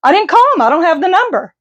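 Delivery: high, breezy voice for a lame excuse; mock innocent excuse. Mocking the relative who says they never called the bank. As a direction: play it airy and innocent, pitched well up. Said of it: "And they're like".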